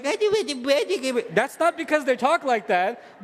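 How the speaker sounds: a light imitation of a babyish kid; childish slow delivery; babyish talk. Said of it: "imitating child's babyish talk".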